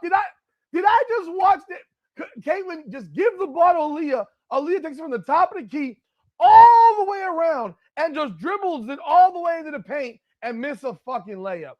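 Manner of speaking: high-pitched, shouting recap; can't believe what you just saw; incredulous rant. Real, not acted. Recapping the blown possession, high incredulous shouting.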